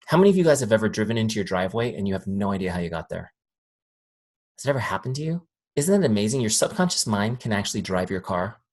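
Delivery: usual speaking voice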